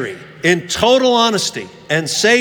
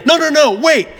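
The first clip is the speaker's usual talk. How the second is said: higher, louder urgent no no no; urgent stop; 9 semitones higher, 4 dB louder than usual. Retold or imitated. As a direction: halt everyone mid-cheat. Student leader halts the class.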